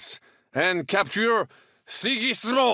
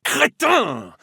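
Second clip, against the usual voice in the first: angry insult; 6 semitones higher, 4 dB louder than usual. Acted. Scolding a clumsy companion.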